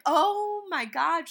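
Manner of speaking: high, loud, drawn-out exasperation; hammed-up fed-up cry; exasperated. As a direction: draw it out slowly, fed up. Acts out exasperated breakup.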